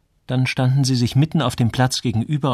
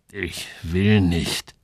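slow, lower, drawn-out refusal; reluctant refusal; 4 semitones lower, much slower than usual. Acted. Samuel balks at being pushed to go, reluctant. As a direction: dig in your heels, drawing each word out.

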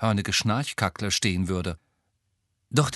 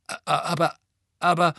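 high, louder flustered stammer; 8 semitones higher, 4 dB louder than usual. Acted. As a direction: stumble over the aber. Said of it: "stotterte Harry". (stotternd, hoch und lauter)

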